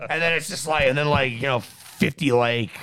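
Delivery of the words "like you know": normal talking voice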